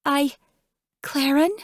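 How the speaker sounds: high, startled gasp of a name. A narrator's reading. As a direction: caught off guard, voice jumping up. Startled friend caught crying.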